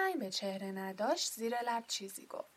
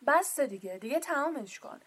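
loud, bossy order; commanding; 4 semitones higher, 7 dB louder than usual. A narrator's reading. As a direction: lay down the law. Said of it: "با حالتی آمرانه گفت".

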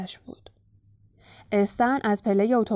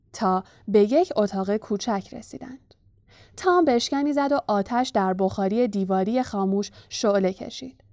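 usual speaking voice

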